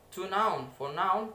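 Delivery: usual speaking voice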